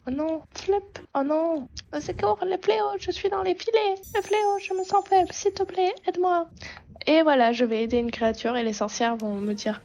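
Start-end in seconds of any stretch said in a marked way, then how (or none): 0.1-6.5 squeaky little creature pleading for help; high creature voice. Acted. Trapped creature begs the horse for help, high squeak. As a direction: pitch way up like a tiny trapped critter. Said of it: "j'en ai marre de faire une voix aiguë".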